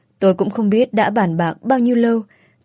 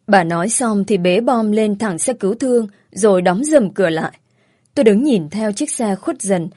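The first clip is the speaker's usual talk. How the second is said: ordinary delivery, nothing marked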